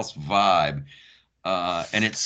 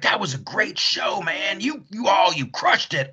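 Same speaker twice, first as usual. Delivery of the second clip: lightly voiced, higher, hearty rock star; friendly rock star; 12 semitones higher than usual. Meatloaf gushing to the opening comic, a lightly voiced higher rave.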